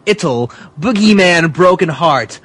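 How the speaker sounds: ordinary talk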